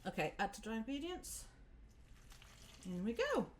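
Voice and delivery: usual speaking voice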